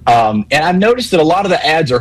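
usual speaking voice

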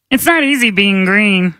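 frog puppet voice; croaky puppet frog; Kermit the Frog. Acted. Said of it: "Kermit the Frog".

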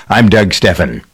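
ordinary talk

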